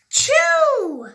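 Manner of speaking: loud, high explosive sneeze; big sneeze. Acted. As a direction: let a huge sneeze go.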